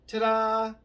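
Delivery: loud, high flourish on the reveal; showy ta-da. Real, not acted. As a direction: announce it like a magic trick's finish. Punchline of the prison lesson.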